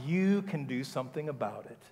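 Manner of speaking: ordinary delivery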